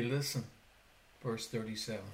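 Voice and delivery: ordinary talk, nothing marked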